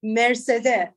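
Mercedes repeated with a Persian r; copying Persian pronunciation. Shown as a demonstration. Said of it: "Not Mercedes".

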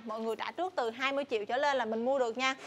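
ordinary talk, nothing marked

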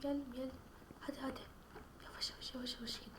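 urgent hushed whisper. Real, not acted. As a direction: whisper so the monster won't hear. Hiding from monster in game.

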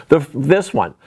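normal talking voice

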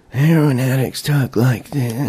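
slow, soft slurred junkie voice; heroin addict voice. Shown as a demonstration. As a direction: drag it out like a strung-out addict.

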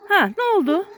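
high pet-talk question; talking to a dog. Real, not acted. Owner questions his dog after the barking, high pet-talk.

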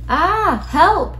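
high, loud cry for help; frightened cry. A narrator's reading. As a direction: yelp in storybook fright. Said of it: "poor Kylie cried".

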